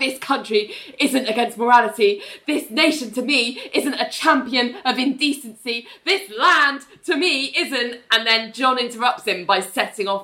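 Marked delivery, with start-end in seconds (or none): 0.0-8.0 mock rally speech, pitched up; impassioned grandstanding. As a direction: orate as if working a crowd. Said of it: "getting more and more, like, impassioned in his, like, speech".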